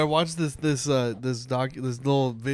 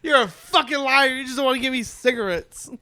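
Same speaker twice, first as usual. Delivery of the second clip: mock angry mom; 9 semitones higher than usual. Friend plays the mom denied cigarettes, mock outrage. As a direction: play an outraged mom accusing her son.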